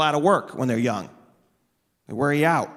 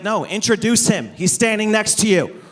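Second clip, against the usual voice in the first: mock impatient, louder, higher push to wrap it up; impatient hurry-up; 5 semitones higher, 4 dB louder than usual. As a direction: hurry him along, exasperated. Impatient aside to long intro.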